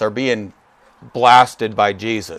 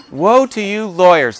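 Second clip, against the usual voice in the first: high, lighter mock denunciation; playful relish; 6 semitones higher than usual. Preacher joking about his favourite verse, playful relish. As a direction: savour it playfully, pitched up without booming. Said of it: "that just sounds great".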